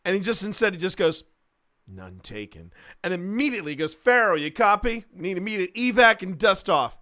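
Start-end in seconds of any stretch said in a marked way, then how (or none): 1.9-2.7 low, quiet deadpan; muttered low dry retort; deadpan none taken. Retold or imitated. Hicks's line quoted again. 3.9-7.0 louder, urgent military radio call; urgent mock radio order. Hicks calling for radio evac.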